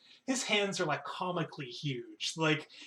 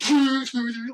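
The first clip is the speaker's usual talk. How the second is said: loud high-pitched clanging noise; imitated metallic clanging; 7 semitones higher, 9 dB louder than usual. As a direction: vocally mimic a dropped hammer clanging. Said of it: "like this really loud metallic clanging".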